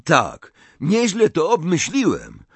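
high, loud self-congratulation; emboldened pride. A narrator's reading. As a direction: boast with new courage, pitch lifted. Malfoy emboldened by praise.

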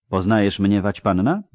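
usual speaking voice

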